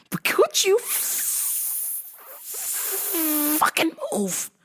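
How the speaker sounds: high, strained, breaking plea; mock desperation, voice cracking up high; exasperated squeal. Exasperated retold plea to customer.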